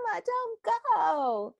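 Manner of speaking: put-on high, slow pleading child; whiny toddler. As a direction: beg like a little kid. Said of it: "she's like".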